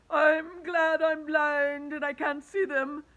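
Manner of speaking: high, loud, slow whining worm; a trembling worm, drawn out and shrill; fretful earthworm. Acted. Earthworm relieved he is blind, high slow whine. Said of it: "the earthworm said".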